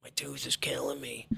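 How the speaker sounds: whispered, hurting tooth complaint; pained whisper. Retold or imitated. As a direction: breathe it out like the tooth is killing you.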